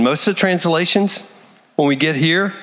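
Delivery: ordinary delivery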